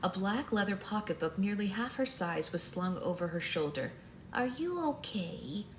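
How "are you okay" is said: put-on high, slow old-lady concern; grandma voice. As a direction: gently check on someone as a grandmother. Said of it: "this is just the regular grandma voice".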